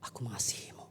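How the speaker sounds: low, hushed, loving assurance; quiet, deep-pitched declaration of love; God's tender reply. Retold or imitated. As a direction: voice God softly, dropping near a whisper. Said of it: "Apa yang Tuhan katakan?".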